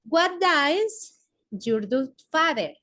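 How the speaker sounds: "What does your father?" stretched very slowly; slow model question, stumbling midway; very slow English model. Shown as a demonstration. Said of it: "como a qué se dedica".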